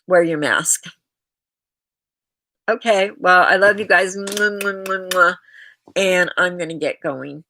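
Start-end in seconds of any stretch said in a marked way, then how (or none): 4.2-5.4 blown kisses. Real, not acted. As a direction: blow kisses to the chat. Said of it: "blowing kisses to viewers".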